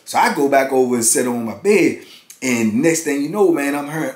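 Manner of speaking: normal talking voice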